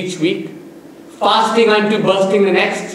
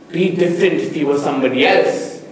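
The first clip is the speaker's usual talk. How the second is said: ordinary talk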